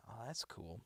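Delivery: normal talking voice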